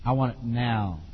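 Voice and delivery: low, quiet no-nonsense command; firm parent. Shown as a demonstration. Parent giving the child an order, low and firm. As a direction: lay it down firmly without raising the voice.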